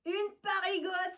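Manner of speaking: high gleeful cry; cat gang jeering; exclaiming. Acted. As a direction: pounce on the word with glee. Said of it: "s'exclame un des félins gredins".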